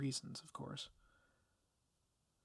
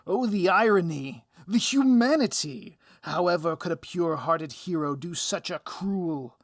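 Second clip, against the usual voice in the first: loud, high, theatrical villain gloating; hammy villain; 3 semitones higher, 13 dB louder than usual. Acted. Villain mocks the hero's cruel dilemma, loud and hammy. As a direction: ham it up like a stage villain.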